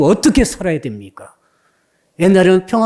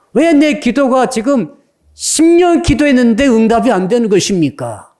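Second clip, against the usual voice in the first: higher, pleading questions at full voice; preaching at full force; 5 semitones higher than usual. Acted. Preacher voicing believers' complaints to God, impassioned preaching. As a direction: cry out each why as a desperate plea.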